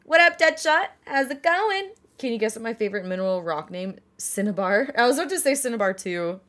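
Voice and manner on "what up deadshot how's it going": goofy greeting voice. Acted. Greeting viewer in a put-on voice.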